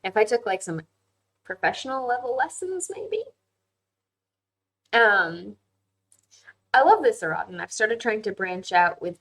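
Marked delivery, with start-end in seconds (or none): none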